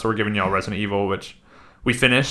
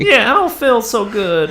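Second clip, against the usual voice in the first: high, louder put-on plant voice; mock sickly plant; 12 semitones higher, 5 dB louder than usual. Joining in as a wilting houseplant, mock sickly. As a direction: play a poorly plant, pitched way up. Playing along as sick plant.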